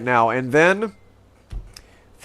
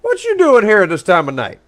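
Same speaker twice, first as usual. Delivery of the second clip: a lightly put-on country fellow, louder and higher; folksy roleplay character; 6 semitones higher, 6 dB louder than usual. Roleplayer greeting a stranger at night, lightly put-on country voice. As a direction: call out to a stranger like a nosy local.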